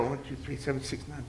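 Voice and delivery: low muttered string of digits; muttering numbers. Retold or imitated. Recalling a number while driving, muttered low. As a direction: mumble the number under your breath. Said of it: "you mutter".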